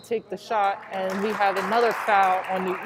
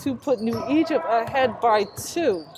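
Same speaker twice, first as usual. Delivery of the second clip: excited call; 4 semitones higher, 7 dB louder than usual. Real, not acted. Commentator to fans, excitedly repeating the go-ahead three. Clutch three-pointer in close game.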